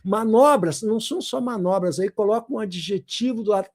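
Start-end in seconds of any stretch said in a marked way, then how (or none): none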